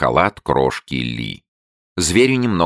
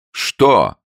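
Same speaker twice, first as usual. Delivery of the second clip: rather sharp, louder; sharp question; 9 semitones higher, 5 dB louder than usual. A narrator's reading. Abe asks the newt sharply. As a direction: snap the question. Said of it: "спросил он довольно резко".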